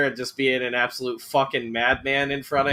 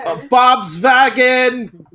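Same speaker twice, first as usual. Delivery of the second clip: high, very slow loud yell; loud cry; 12 semitones higher, 11 dB louder, much slower than usual. Pretend. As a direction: bellow it out, stretched long. Sudden loud exclamation.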